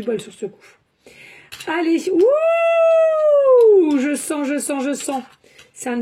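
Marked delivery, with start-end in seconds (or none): 2.1-4.0 long, high, loud "wooo"; excited whoop. Real, not acted. Reader reacting to the cards, excited whoop. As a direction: let out a drawn-out high whoop.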